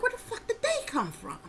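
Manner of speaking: high, bewildered outburst; baffled. Retold or imitated. Waking from the dream, she squeaks out her baffled question. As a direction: squeak it out in disbelief.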